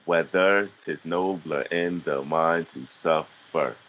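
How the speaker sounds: slow, deliberate reading of Shakespeare; reciting verse slowly. Shown as a demonstration. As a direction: spell out each syllable at an unhurried pace. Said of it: "we'll do it again".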